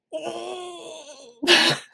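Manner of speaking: high, loud disbelieving laugh; a pained cry of a laugh; stunned cry-laugh. Real, not acted. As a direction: laugh out in shock. Shocked at police report.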